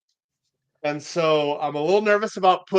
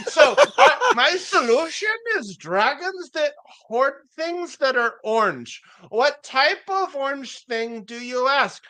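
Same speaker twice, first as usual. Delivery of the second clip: put-on comic foreign accent, pitched well above usual; [name]'s foreign accent; 8 semitones higher than usual. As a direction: plead the town's case in a hammed-up foreign accent. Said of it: "I have to speak in a foreign accent".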